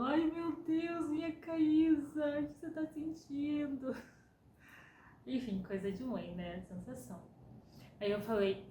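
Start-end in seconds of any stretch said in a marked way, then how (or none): 0.0-4.0 higher, slow fussing mother imitation; worried mother. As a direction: imitate an anxious mom fretting over her daughter. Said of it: "minha mãe chegou toda preocupada".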